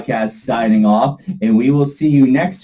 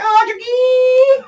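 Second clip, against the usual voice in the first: a much higher shouted old-man cry, hammed-up; cartoon grandpa shout; much slower than usual. Podcast host imitating the Jackie Chan Adventures grandpa, high mock shout. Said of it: "the grandpa used to be like".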